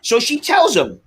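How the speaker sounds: usual speaking voice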